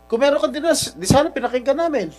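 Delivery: heated, high-pitched rebuke. Real, not acted. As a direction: rebuke the witness with real anger. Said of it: "raising my voice". (pagalit na sumbat, mataas ang boses)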